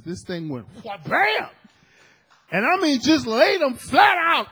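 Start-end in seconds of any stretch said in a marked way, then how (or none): 1.1-4.5 loud, high cry of victory; triumphant shout. Retold or imitated. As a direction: shout it out like a knockout win. Victory in the dream.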